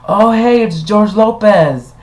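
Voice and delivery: high, loud call of recognition; mock excited recognition. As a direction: imitate a guest hailing a celebrity. Imitating guests spotting a lookalike.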